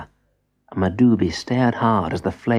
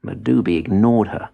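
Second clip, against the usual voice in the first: ordinary delivery, nothing marked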